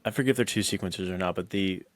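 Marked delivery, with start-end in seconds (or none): none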